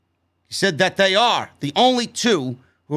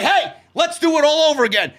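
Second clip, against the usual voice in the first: 1 mock sarcasm; 6 semitones higher than usual. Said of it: "and I'm gonna say".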